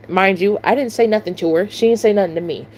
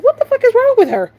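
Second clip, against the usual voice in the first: much higher, incredulous outburst; shrieking disbelief; 13 semitones higher, 3 dB louder, faster than usual. Retold or imitated. Cashier telling her friend about the customer, high incredulous outburst. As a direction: still can't believe it.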